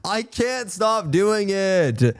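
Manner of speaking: high, very slow country-accent moan. Real, not acted. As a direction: stretch it into a helpless country-accent wail. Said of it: "What happened to your accent?".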